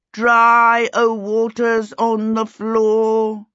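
slow, sustained incantation on a level pitch; drawn-out, ringing spell-casting voice; intoned spell. A narrator's reading. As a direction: intone it like a solemn magic chant. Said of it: "he intoned".

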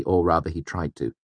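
usual speaking voice

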